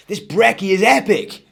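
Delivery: put-on a chirpy young lad raving about breakfast; Eric's voice. Eric's voice, child hero.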